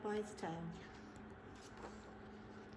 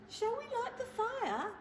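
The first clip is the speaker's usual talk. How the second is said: much higher, polite question; little mouse voice; 11 semitones higher than usual. Acted. The mouse cheerfully asks Bear about the fire. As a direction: ask brightly like a tiny mouse. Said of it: "asked the mouse".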